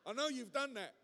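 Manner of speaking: ordinary talk, nothing marked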